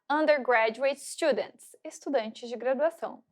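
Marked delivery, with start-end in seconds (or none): 0.1-1.8 slow, careful English model word; careful teacher model; model pronunciation. Shown as a demonstration. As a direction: say it slowly for students to repeat. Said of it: "Então, vamos lá".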